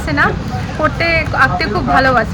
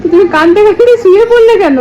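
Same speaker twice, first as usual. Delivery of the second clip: high, loud exclaimed question; parent's loud, high 'why'; 7 semitones higher, 9 dB louder than usual. Real, not acted. Mother teases child lying down crying.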